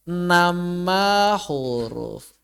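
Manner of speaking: nama huruf drawn out slowly and softly; slow stress. Shown as a demonstration. Teacher drawing out "nama huruf" for the class, slow and soft.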